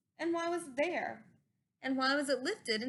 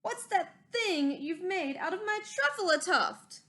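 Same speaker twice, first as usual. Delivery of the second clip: higher, louder, indignant shout; upset shout; 6 semitones higher, 6 dB louder than usual. A narrator's reading. Lorax shouting at the Onceler over his tuft, upset. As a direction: puff up and demand an answer. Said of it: "very upset as he shouted and puffed".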